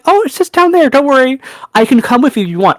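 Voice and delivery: high, sugary helpful-clerk voice, imitating work self; mock customer-service voice. Sweet retail help to customer.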